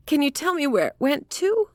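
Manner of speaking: high, imploring woman's question; emotional cry. A narrator's reading. Woman begging detective where bird went, emotional cry. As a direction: plead urgently in a high voice. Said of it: "she cried".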